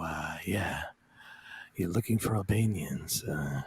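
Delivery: low, quiet, halting mumble; Steven Seagal. Acted. Mock Seagal replying to a kidnapper's threat, low hushed impression. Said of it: "Steven Seagal would say".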